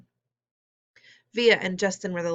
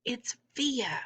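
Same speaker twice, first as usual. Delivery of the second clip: hushed, soft and higher; whisper; 5 semitones higher, 5 dB quieter than usual. A narrator's reading. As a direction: whisper it across in amazement. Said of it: "Mom whispered to him".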